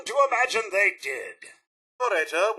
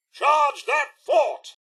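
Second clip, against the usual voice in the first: slow, loud rallying shout; rally the troops up the hill; battle cry; 8 semitones higher, 4 dB louder, much slower than usual. Acted. Robot ordering a charge.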